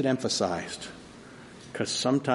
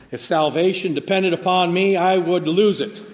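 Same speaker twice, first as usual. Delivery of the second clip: higher and louder quote; quoted believer; 6 semitones higher, 6 dB louder than usual. Retold or imitated. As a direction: lightly voice the confessing saints. Quoting past believers.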